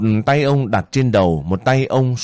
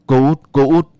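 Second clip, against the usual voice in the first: guarded night call, slightly higher; calling at the door; 3 semitones higher, 3 dB louder than usual. A narrator's reading. Soldier calling Út at her door at night, guarded call. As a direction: lightly call out to someone inside.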